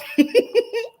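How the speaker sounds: high burst of laughter; laughing harder at his own line. Acted. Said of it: "laughed even harder".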